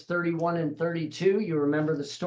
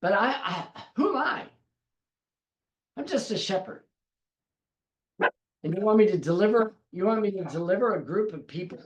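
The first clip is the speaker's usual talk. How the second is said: doubting Moses; 5 semitones higher than usual. Acted. Moses doubting himself before God.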